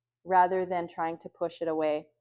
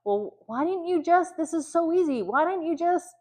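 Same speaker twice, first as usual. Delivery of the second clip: exasperated parent; 8 semitones higher than usual. Retold or imitated. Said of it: "you ask him".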